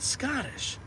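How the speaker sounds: Scottish with a high, rolled Scottish lilt; Scottish accent. Shown as a demonstration. Showing chat the actor's Scottish accent. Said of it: "has a pronounced Scottish accent".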